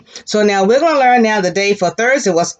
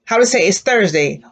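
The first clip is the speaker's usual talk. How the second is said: usual speaking voice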